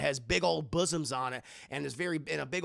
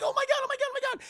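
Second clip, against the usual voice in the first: much higher frantic repeating; high panicked cry; 3 dB louder, much faster than usual. Acted. Willie panicking as bugs crawl over her. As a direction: squeal in rising panic.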